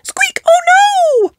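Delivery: very high startled squeak; a little gasp of alarm. Acted. Rebecca startled by the babies crying, squeaking. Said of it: "gasps Rebecca".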